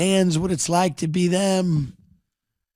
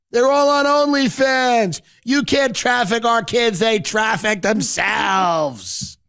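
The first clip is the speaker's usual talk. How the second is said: Rick rally speech; 8 semitones higher than usual. Retold or imitated. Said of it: "Everyone's clapping".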